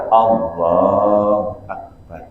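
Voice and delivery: very slow, drawn-out low takbir. Retold or imitated. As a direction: imitate an unhurried imam's opening takbir. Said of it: "Pelan-pelan".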